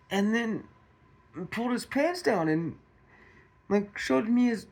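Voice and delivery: high-pitched, distressed retelling of her confession; imitated shaken wife. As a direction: act out an upset wife, voice lifted high. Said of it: "and she's shaking".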